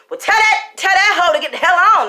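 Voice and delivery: high, loud exasperated holler; fiance yelling. Retold or imitated. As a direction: bark it out, fed up and startled. Fiance reacts to ghost talk.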